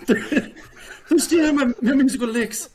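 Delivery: put-on laughing through a high Irish accent. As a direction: keep the jokey Irish accent going. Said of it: "do your best Irish accent".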